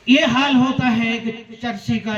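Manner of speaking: ordinary talk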